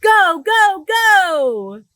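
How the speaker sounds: loud, high go go go; excited loud cheer. Acted. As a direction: cheer the ostrich on. Cheering the running ostrich.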